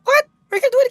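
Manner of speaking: very high, loud startled cries; shocked shriek. Real, not acted. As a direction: yelp in disbelief at the screen. Sudden in-game shock.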